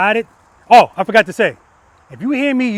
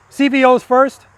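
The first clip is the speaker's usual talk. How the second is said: teasing, louder and higher mock command; mock order; 6 semitones higher, 6 dB louder, slower than usual. Instructor joking about who rides first, mock command. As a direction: bark it as a fake order, then drop it. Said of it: "I'm kidding".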